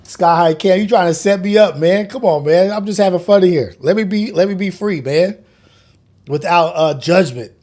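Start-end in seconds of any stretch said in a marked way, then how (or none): none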